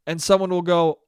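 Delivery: normal talking voice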